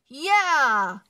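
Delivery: loud, high group cheer of agreement; shout. Acted. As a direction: shout along with the team. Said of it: "they shouted".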